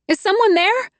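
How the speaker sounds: high, loud call into the dark. A narrator's reading. Trapped woman calling up to a stranger, loud and high. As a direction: call out, scared and alone. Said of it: "I called out".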